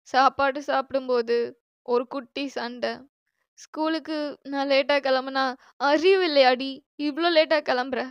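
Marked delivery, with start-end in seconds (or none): 5.8-8.0 loud imitation of a sisterly telling-off; mock scolding. Sister recalling scoldings for leaving late, mock scolding. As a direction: scold sharply, as remembered banter. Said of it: "அப்படின்னு திட்டுறதுக்கு".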